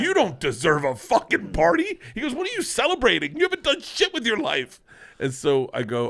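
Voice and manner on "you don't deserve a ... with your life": much higher and louder, a mock scolding father; angry dad. Dad scolding his son for wanting to party. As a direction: bark it like an outraged dad.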